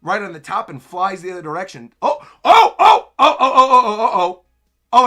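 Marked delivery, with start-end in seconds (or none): 2.0-5.1 rapid string of high, loud ohs; excited realization. Real, not acted. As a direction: burst out as it suddenly clicks. Sudden realization while reading.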